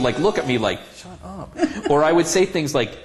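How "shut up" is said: hushed shut up. Retold or imitated. Partner silently telling him to hush.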